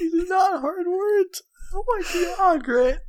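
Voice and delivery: high squealing laugh-talk; wheezing with laughter; laughing hysterically. Real, not acted. Co-host laughing at him.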